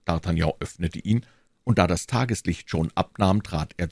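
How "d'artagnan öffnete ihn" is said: ordinary delivery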